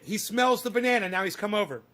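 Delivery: usual speaking voice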